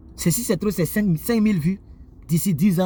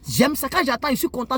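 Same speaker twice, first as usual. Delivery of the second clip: ordinary talk, nothing marked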